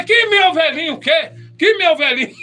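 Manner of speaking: high, scornful indignant retort. Retold or imitated. To the pastor, a high scornful indignant retort. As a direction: throw the pet name back at him.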